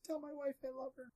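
high, faint, far-off gasp of a dying man; dying man's last words. Acted. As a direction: gasp it out like a fallen soldier. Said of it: "All you hear as you're running away is".

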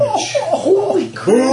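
high, loud stunned cry; shocked exclamation. Real, not acted. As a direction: blurt it out in disbelief. Huge damage roll reaction.

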